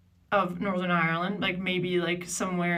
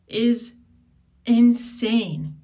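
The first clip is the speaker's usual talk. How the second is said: outraged; 4 semitones higher, 9 dB louder, much slower than usual. Real, not acted. Outraged rant, first take.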